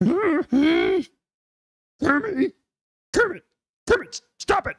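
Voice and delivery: very high puppet pig voice; Miss Piggy voice. Acted. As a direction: call out like a diva pig. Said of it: "some humans and some pigs".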